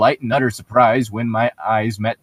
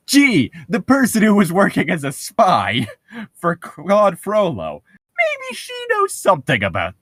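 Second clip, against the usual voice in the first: mocking voice; 8 semitones higher than usual. Mock voice of herself wondering.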